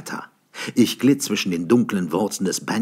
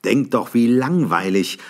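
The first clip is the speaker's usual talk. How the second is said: mocking; 5 semitones higher, 7 dB louder than usual. A narrator's reading. Mocking retort.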